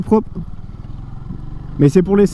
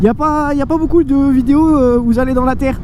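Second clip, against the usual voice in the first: mock commenter voice; 7 semitones higher than usual. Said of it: "des commentaires qui disaient".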